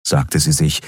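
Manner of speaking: ordinary delivery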